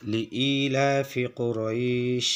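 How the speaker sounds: slow recited কোরাইশ stopped with waqf; Quranic recitation. Shown as a demonstration. Teacher slowly recites the verse for students, drawn out. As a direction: recite লিইলাফি কোরাইশ slowly, ending on a clean stop. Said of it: "ওয়াকফ করলে কোরাইশ".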